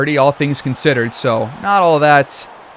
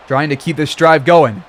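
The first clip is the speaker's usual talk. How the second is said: usual speaking voice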